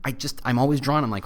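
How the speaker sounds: ordinary talk, nothing marked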